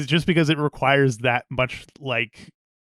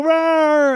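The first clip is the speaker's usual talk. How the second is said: high, loud mock roar; dinosaur voice; 14 semitones higher, 6 dB louder than usual. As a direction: roar like a cartoon beast. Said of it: "They all go".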